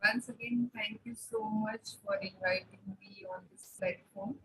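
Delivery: faint, distant mic voice; speaking far from the microphone; barely audible. Real, not acted. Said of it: "but your voice is very slow".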